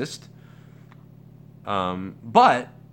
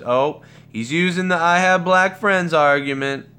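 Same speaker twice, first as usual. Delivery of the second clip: raised, louder, slow sneering mock quote; mocking accusation; 6 semitones higher, 6 dB louder than usual. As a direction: mimic people dismissing you, higher and slower. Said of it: "people see that as".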